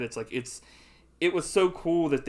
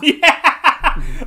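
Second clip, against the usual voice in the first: laughing; 16 dB louder than usual. Real, not acted. Laughing at the joke.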